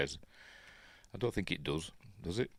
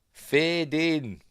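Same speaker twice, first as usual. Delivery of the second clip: quoting Kathleen Kennedy; 8 semitones higher, 3 dB louder than usual. Retold or imitated. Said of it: "as Kathleen Kennedy likes to say".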